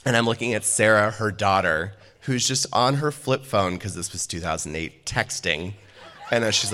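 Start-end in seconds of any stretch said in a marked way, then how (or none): none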